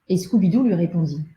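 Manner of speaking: ordinary delivery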